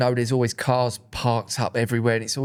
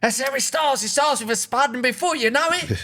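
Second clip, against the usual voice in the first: high, hammed-up worried bloke voice; mock paranoid mate; 8 semitones higher than usual. Mocking the OSB's fear, high hammed-up panicky voice. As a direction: play him as a panicking alarmist. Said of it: "He's like".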